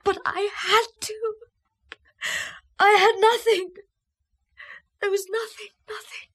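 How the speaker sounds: high, fragile voice fading away; tearful confession. Acted. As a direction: confess through tears, trailing to almost nothing.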